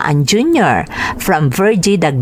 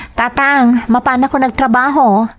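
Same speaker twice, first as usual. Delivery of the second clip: put-on higher, earnest young daughter asking her father; 5 semitones higher than usual. Daughter asking her father where he works, higher voice. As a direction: play the worried daughter, pitched up.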